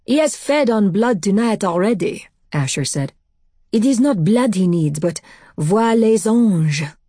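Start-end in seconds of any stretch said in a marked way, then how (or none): 0.1-2.3 put-on higher, accented French voice, agitated; great distress. A narrator's reading. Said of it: "Asher was in great distress". 3.7-6.9 put-on higher French-accented voice, straining for words; urgent French accent, slipping into French; great distress. A narrator's reading. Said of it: "in great distress if his English had abandoned him".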